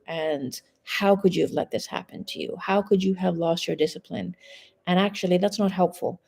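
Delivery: ordinary delivery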